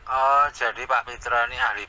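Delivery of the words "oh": usual speaking voice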